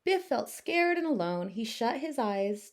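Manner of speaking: usual speaking voice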